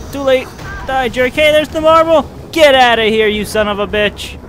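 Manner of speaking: loud, high battle yells; combative shouting. Real, not acted. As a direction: shout at the enemy as you attack. Attacking the marble in game.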